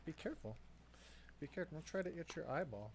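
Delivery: gentle warning to the puppy; pet talk. Real, not acted. Talking to her dog.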